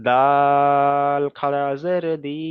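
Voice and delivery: slow, drawn-out letter-by-letter chant; slow recitation drill. Shown as a demonstration. Teacher modelling the letter line for students, slow chanted recitation. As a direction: recite each letter as a model, stretched slowly. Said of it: "তাহলে এখানে".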